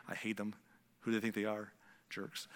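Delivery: low, quiet grumbling under the breath; jealous grumble. Acted. Envious neighbor grumbles about the lottery winners, low and resentful. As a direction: grumble it privately, dropping away to almost nothing.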